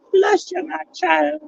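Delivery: high, lofty vicar voice; priestly blessing. Pretend. Sneeze, then a mock blessing.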